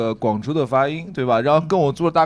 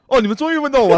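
excited; 8 semitones higher, 5 dB louder, faster than usual. Real, not acted. Finally asked, mock relief.

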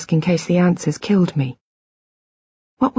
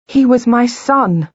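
higher and louder, slow and anguished; a mother's anger breaking through, slowly; angry outburst; 5 semitones higher, 4 dB louder, slower than usual. A narrator's reading. Wife to husband about their dead son, angry and slow. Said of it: "My anger surged".

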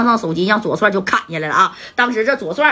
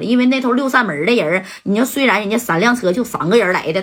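usual speaking voice

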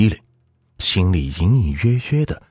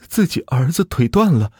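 high, anxious pleading voice; frightened family member; 13 semitones higher than usual. Acted. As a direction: explain it nervously, pitched up.

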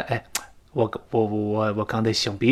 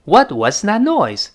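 slow clear reading; 7 semitones higher, 4 dB louder, slower than usual. Shown as a demonstration. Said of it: "英语中有时态".

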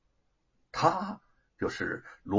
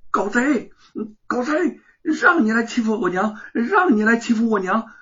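high, loud furious yelling; angry shout; 9 semitones higher, 6 dB louder than usual. A narrator's reading. Boy punching the thief who attacked his mother, angry shout. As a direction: rage at him with every word.

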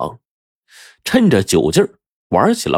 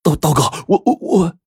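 higher, broken syllables, tripping on every word; frightened stammer; 8 semitones higher than usual. A narrator's reading. Debtor faces the gang leader, stammering in fear. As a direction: stutter in fear, unable to finish. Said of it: "结结巴巴地说".

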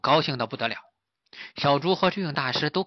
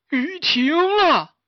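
very high, loud, drawn-out cry; amazed exclamation; 6 dB louder, slower than usual. Acted. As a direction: stretch out the delighted discovery. 大师忽然发现雨停了. (惊喜地拖长声高喊)

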